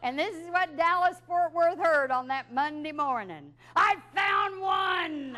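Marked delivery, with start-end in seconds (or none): 3.8-5.4 very slow, drawn-out yell, higher; thrilled rookie reporter hollering into the live mic; excited shout. Retold or imitated. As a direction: blurt it out over the whole city. Shouting on air by mistake.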